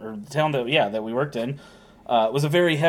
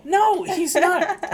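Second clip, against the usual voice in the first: high, drawn-out playful "no"; mock indignant denial; much slower than usual. Real, not acted. Husband jokingly denying his friend is American, drawn out. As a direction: stretch it out in mock protest.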